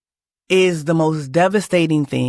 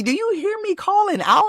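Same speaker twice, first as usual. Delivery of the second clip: much higher, a pleading indignant cry to the sky; exasperated outcry; 14 semitones higher than usual. Retold or imitated. She retells crying out in her dream, exasperated and pitched high. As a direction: call out to heaven in disbelief.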